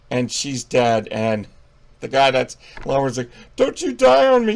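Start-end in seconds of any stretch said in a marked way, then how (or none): 3.6-4.5 loud, high-pitched mock movie cry; over-the-top begging, pitched way up; melodramatic plea. Lover pleading over the dying girl, melodramatic.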